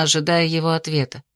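plain reading voice

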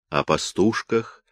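ordinary delivery, nothing marked

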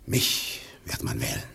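low breathless whisper; secret whisper. Acted. Gregory whispering his election secret to Simon. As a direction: lean in and whisper it.

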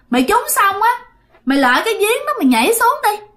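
high, insistent order; acted bossy demand; commanding spirit. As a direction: lay down the terms firmly. Spirit orders him into the well.

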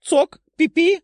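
high squeaky chatter; squirrel squeak. Acted. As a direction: chirp it like a tiny squirrel. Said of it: "заявила белка".

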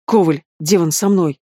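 ordinary delivery

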